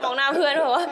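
amused mock skepticism; retold disbelief. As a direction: act out doubting it to a friend, through laughter.

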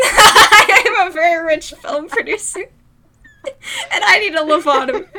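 loud, laughing fake British accent; hammed-up British accent through laughter; bad fake British accent. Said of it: "that really bad fake accent".

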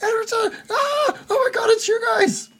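very high, garbled squeal of joy; hammed-up, half-sobbing high shriek; screaming near-gibberish. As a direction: babble hysterically, overjoyed to see lost friends. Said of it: "he's screaming ... It sounds like gibberish".

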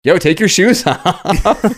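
put-on hyped teenage dare through a laugh; mock teen egging on.

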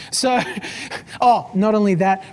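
usual speaking voice

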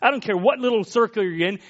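normal talking voice

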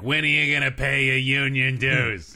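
loud, high gruff boss voice; gruff Bob Newhart. Pretend. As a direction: bark it like a grumpy union boss. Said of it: "Bob Newhart is known for being loud and gruff".

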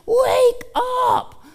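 sudden high yell; high shout of wake up; shouting someone awake. Retold or imitated. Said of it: "you're like".

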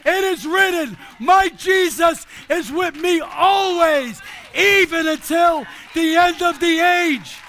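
emphatic, drawn-out declarations of scripture; full-force preaching. Real, not acted. Believer answering the devil with scripture, full-force proclamation. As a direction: proclaim each promise with full conviction.

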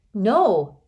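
loud, sharp "No!"; boy's forceful shout. A narrator's reading. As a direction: stop them all with one shout. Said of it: "Rainey shouted".

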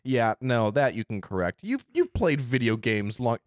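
usual speaking voice

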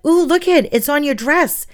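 high, delighted ooh; thrilled, pointing it out to a child; excited. Retold or imitated. Teacher showing a child the fairy on her dress, high and thrilled.

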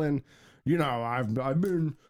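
normal talking voice

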